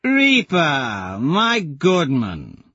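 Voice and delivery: bellow. Acted. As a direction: bellow like a showman. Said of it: "Tactus bellows".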